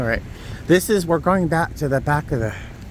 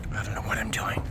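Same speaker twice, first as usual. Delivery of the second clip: whispered aside; 5 semitones lower, 10 dB quieter, faster than usual. Real, not acted. Vlogger whispering while digging through bushes. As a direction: whisper to the camera.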